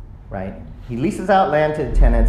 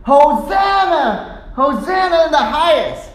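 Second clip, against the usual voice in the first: high-pitched jubilant call; children shouting; 10 semitones higher, 3 dB louder than usual. Acted. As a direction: cry out like an excited child. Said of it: "The children were shouting".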